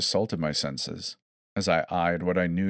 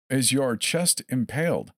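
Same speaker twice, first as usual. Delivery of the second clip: sing-song quizzing; 7 semitones higher than usual. A narrator's reading. Captor quizzing cheerfully.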